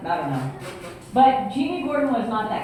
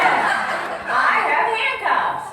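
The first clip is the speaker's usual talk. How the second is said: laughing; 10 semitones higher, 5 dB louder, much slower than usual. Retold or imitated. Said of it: "He said".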